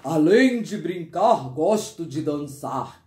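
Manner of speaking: storybook uncle, set below the narrator; voiced man in a story. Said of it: "Ele falou pra gente".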